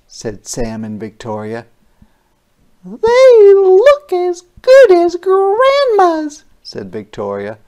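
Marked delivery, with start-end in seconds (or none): 2.8-6.4 put-on little girl's voice. Victoria praising the cookies.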